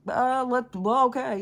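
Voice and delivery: high, loud flustered stammer; a parent caught off guard, blurting. Retold or imitated. A flustered parent guessing at a problem, high and loud.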